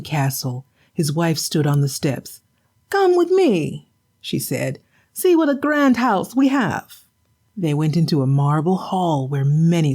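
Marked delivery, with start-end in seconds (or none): 2.9-3.9 high, nagging wife's voice; a shrewish wife, higher and sharp; scolding wife. Acted. Wife scolding her husband for an empty catch. 5.1-7.0 high, nagging wife's voice; a shrewish wife, higher and sharp; scolding wife. Acted. Wife scolding her husband for an empty catch.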